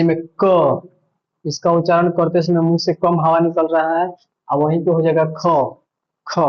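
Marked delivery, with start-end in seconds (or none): 6.3-6.4 ख said with a strong puff of breath; aspirated kh. Shown as a demonstration. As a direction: push plenty of air out on ख. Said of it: "ज्यादा हवा निकल रहा है ख". (ख को ज़्यादा हवा के साथ बोलिए)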